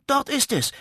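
sehr hoch und laut gerufen; plötzlicher Ruf. Acted. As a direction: ruf es aufgeregt heraus, als hättest du es gerade entdeckt. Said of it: "rief er plötzlich". (Zwerg Goliath zeigt der Riesin das Haus, hoher lauter Ruf)

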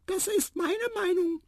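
very high, firm little voice; squeaky mouse. A narrator's reading. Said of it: "piepste Tiputip". (bestimmt piepsende Maus)

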